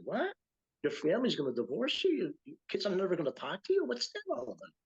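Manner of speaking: high, fast incredulous questions; imitating his shocked self; retold disbelief. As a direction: sound stunned at what you hear, pitch way up. Retold shock at shunning news.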